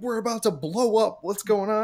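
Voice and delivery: high-pitched mock panic; retold freak-out. Passenger fearing the train will explode, retold panic. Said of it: "I'm freaking out".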